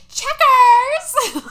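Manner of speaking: hammed-up high shriek calling a pet; very loud, high-pitched mock call for the dog; Dolly. Dolly calling for her dog, high hammed-up yell. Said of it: "Dolly is like".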